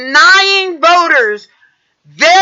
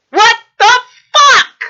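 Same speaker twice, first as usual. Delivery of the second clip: higher, louder disbelieving burst on fuck; furious what the fuck; 6 semitones higher, 3 dB louder than usual. Real, not acted. Vlogger exploding at people attacking officials, higher and louder. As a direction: spit it out in angry disbelief. Ranting at Republicans.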